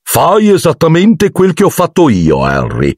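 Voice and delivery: a vain teacher cheerfully instructing his student; cheerful Lockhart. A narrator's reading. Lockhart coaching Harry before the duel, cheerfully. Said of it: "batté allegramente".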